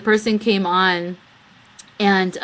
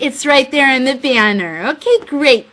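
louder, pointed sarcastic jab; 6 semitones higher, 7 dB louder than usual. Retold or imitated. Answering Addison about the show time, mock sarcastic. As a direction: say it as an exasperated sarcastic aside. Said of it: "I'm like".

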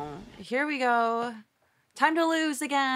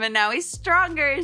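ordinary talk, nothing marked

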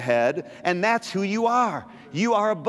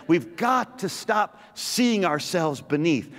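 ordinary delivery

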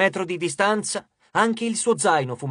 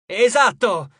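emphatic shout; 4 semitones higher, 6 dB louder, slower than usual. A narrator's reading. Percy shouts back at the goddess.

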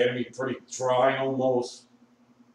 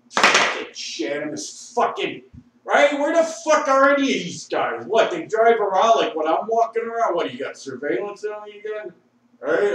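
higher, louder indignant rant; 9 semitones higher, 7 dB louder than usual. Real, not acted. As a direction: yell it at the cops who aren't there. Drunk rant at absent cops.

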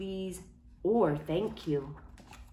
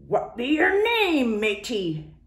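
higher, louder, slow pirate question; pirate asking; 4 semitones higher, 4 dB louder, slower than usual. Acted. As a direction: ask it like a gruff captain. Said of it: "the head pirate asked".

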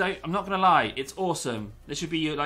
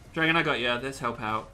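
ordinary delivery

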